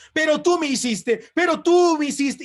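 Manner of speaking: high, loud whiny mimic of a grudge-holder; mock nagging reproach. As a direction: throw the old fault back, mockingly. Husband mimicking wife's old grievances.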